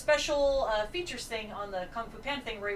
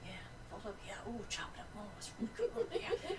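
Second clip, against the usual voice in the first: very soft, whispery murmur; talking to oneself; 11 dB quieter, much faster than usual. Retold or imitated. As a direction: egg it on under your breath, entranced. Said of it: "I'm just talking to myself".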